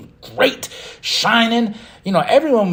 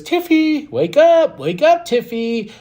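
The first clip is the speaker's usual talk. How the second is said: high, loud mock call to wake Tiffy; a playful impression of Charlotte, pitched way up; imitating Charlotte; 10 semitones higher, 3 dB louder, slower than usual. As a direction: chirp it up high like Charlotte rousing her. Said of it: "She goes".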